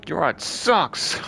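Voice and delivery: slow, loud high jeer; drawn-out scornful taunt; mocking taunt. Real, not acted. Mocking the graffiti.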